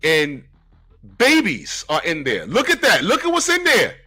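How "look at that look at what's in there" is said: amazed. Real, not acted. Amazed at comic page.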